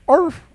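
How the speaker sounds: sudden high, loud yap; dog bark. Acted. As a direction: bark once like an eager dog. Acts the dog's reply.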